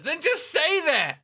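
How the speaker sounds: high, slow annoyed yell; exasperated shout. Acted. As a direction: snap at him. Beat exasperated.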